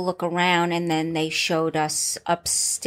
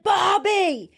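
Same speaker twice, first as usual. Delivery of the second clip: shouted name in a mock English accent, much higher and louder; loud English-accent yell at the cook; 11 semitones higher, 4 dB louder than usual. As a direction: call out across the kitchen like an English chef. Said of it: "Was that your English accent".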